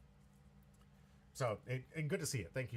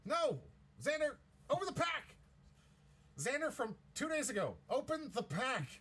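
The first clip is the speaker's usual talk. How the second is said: high, loud yell at the screen; frustrated yell; 12 semitones higher, 3 dB louder than usual. Real, not acted. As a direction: shout at someone who can't hear. Yelling at past recording.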